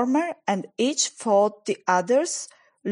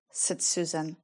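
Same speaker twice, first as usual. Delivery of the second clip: plain reading voice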